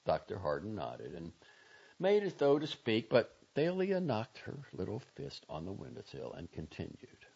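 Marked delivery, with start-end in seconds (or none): none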